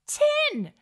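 high, loud gushing; excited. Acted. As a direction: shout it out in amazement. Gushing over range.

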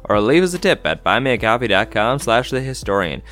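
podcast outro read. Acted. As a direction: announce the socials loudly.

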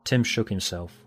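ordinary delivery

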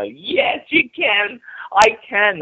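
mock swooning footballers. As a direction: ham up the lovestruck players squealing with delight. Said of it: "tackling, and they'll go".

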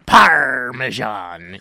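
very slow pirate drawl on Parmesan. Shown as a demonstration. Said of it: "Like a pirate?".

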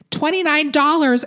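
high mock yell of the mother. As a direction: shout the price over her daughter. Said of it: "Andrea yells".

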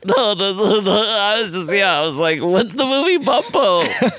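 laughing, high, after a bug flew in; high laughing voice, something in the mouth; just swallowed a bug. Real, not acted.

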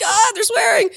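very high-pitched startled squeal; mock alarm. Traveller unaccustomed to getting yelled at, mock alarm. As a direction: play-act being rattled, way up high. Rattled by officers yelling at her.

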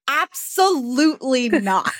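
"Absolutely not" slowed, syllable by syllable; imitating a firm, drawn-out "Absolutely not"; retold emphatic refusal. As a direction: stretch out Absolutely in a mock refusal. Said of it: "She's like".

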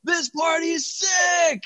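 high, drawn-out mock yell held back in level; mock party excitement. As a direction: stretch it out like a thrilled partygoer.

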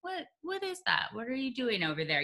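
high, soft intrigued murmur; hushed curiosity, drawing someone in; soft high teasing question; curious hushed. Retold or imitated. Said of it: "you want it to just sort of go".

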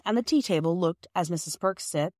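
ordinary delivery, nothing marked

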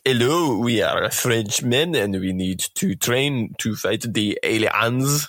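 playful hammed-up French accent, slowed down; mock French accent. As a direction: put on a jokey French accent like a Frenchman rallying troops. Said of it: "that was a really good accent".

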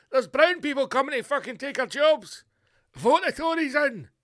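high, loud mock alarm; tabloid scaremongering. Mocking the Daily Mail's front page to voters, in high, hammed-up alarm. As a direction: parody a panicky newspaper headline. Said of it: "the Daily Mail will do the usual".

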